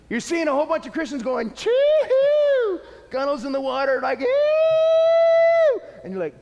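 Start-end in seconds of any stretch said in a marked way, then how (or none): 1.5-2.8 high, loud celebratory "chee-hoo"; piercing, drawn-out joy whoop; exuberant whoop. Retold or imitated. Imitates Christians whooping while sailing by. 4.2-5.8 very high, loud held squeal; long piercing imitated whoop; gleeful squeal. Imitates boaters speeding gleefully by.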